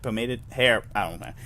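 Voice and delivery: ordinary delivery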